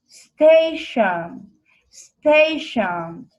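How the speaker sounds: each word said twice, the first syllable hit loud; teacher modelling two-syllable stress. Shown as a demonstration. Teacher models stress for students to repeat, first syllable punched. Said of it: "Pay attention to the syllable stress".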